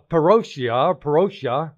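parotia said slowly, syllable by syllable; Greek word said slowly. Shown as a demonstration. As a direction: sound out parotia with care. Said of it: "This word stay is".

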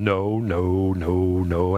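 God calmly rejecting each name. Acted. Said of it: "he's going".

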